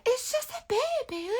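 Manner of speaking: high, soft cooing voice; baby talk. Shown as a demonstration. Showing viewers how to talk gently to the brush, high soft coo. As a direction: coo it like talking to an infant. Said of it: "Light hand".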